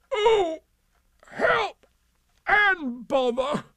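very high, loud, drawn-out cry; hammed-up stuck-bear wail; wailing for help. A narrator's reading. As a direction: call out helplessly, stretching each word. Pooh stuck, calls out.

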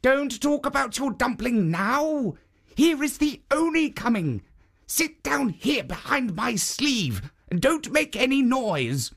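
urgent statue. A narrator's reading. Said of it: "But the Jitso said".

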